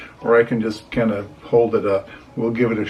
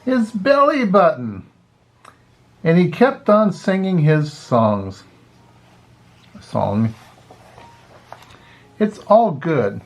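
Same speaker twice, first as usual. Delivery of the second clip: put-on reading to children; 2 semitones higher than usual. Grandpa reads Pete the Cat to kids, lively storytelling. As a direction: read it like a grandpa sharing a picture book.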